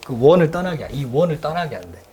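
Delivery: ordinary talk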